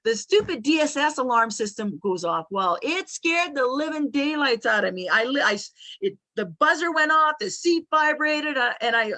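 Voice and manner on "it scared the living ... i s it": usual speaking voice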